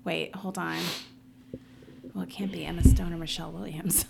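whispered guessing to herself; hushed aside while guessing. Real, not acted. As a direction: mutter the guesses under your breath.

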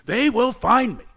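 high, slow, dramatic film quote; movie-hero impression. Quoting Last of the Mohicans, in drawn-out heroic cry. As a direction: draw out the vow with mock-epic force. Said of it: "It's like the last of the Mohicans".

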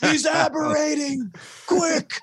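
high urgent shout, mock emergency; man down shout. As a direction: yell it like a man is down. Mock alarm, calling for a medic.

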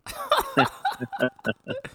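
high, nervous giggle; nervous laugh. Real, not acted. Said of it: "see that nervous laughter there".

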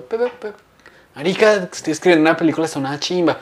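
high, bubbly burst of news; retold excited arrival. Husband coming home from work to his wife, excited.

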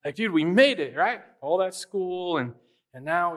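excited buddy reliving the moment; retold excitement. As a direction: celebrate to a glum friend. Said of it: "I'm really excited".

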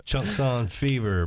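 slow announcer title; show announcer. Acted. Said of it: "brought to you by".

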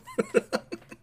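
squeaky cartoonish cackle; gleeful cackle; very high laugh. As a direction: let out a tiny high cackle.

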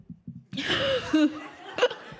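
high breathless giggle; nervous laugh. Real, not acted. Nervous laughter mid testimony.

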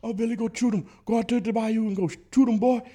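very high, loud Cajun holler; Cajun hunter impression. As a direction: put on a swamp hunter's Cajun accent. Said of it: "I could kinda do it for".

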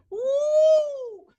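a high squeal of recognition; excited ooh. Real, not acted. Excited exclamation before example.

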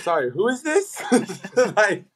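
asking it through a laugh, pitched high; can't get the words out without giggling. Retold or imitated. Player retelling his confused answer to the coach's call, laughing.

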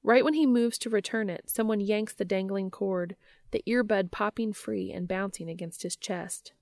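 usual speaking voice

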